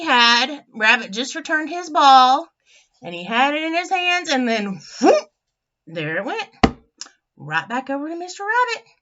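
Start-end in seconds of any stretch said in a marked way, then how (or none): 5.0-5.3 quick high, loud whoop; sudden rising whoop. Retold or imitated. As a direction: imitate a ball flying off with a whoop. Imitates ball flying away.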